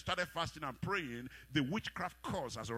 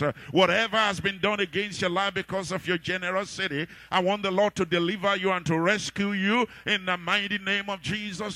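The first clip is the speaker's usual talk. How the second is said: higher, louder preaching prayer; preaching at full force; 7 semitones higher, 13 dB louder than usual. Acted. Preacher prays over the congregation, preaching hard. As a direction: drive the prayer home hard.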